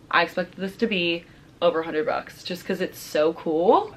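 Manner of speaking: ordinary talk, nothing marked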